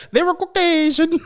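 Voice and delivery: high lilting They were Caucasian; mock-posh Caucasian. Shown as a demonstration. Repeating the bit, high lilting Caucasian. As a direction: say it like a fancy label. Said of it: "saying it like that".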